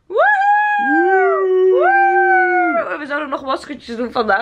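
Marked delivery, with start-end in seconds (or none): 0.1-3.0 high, loud whooping; excited cheer. Real, not acted. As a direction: whoop it out with joy. Cheering at end of day.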